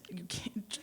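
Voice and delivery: ordinary delivery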